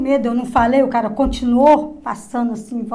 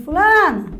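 calling out; 6 semitones higher, 7 dB louder, slower than usual. Retold or imitated. Frightened woman calls out to her neighbour, high and loud. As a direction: call the name high and loud.

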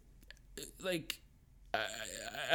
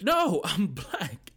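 laughing reply; 7 semitones higher, 6 dB louder, much slower than usual. Retold or imitated. Answering accusers while laughing.

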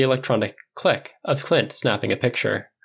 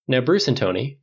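ordinary delivery

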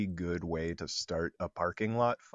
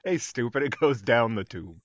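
scolding; 4 semitones higher, 7 dB louder than usual. Retold or imitated. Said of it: "acting out inner scolding voice".

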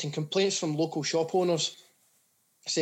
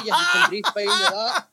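punchline broken up by laughter, higher and louder; can't hold the laugh in; laughing through the last line; 13 semitones higher, 6 dB louder, slower than usual. Real, not acted.

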